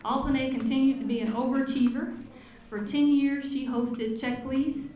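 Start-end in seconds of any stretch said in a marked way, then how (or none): none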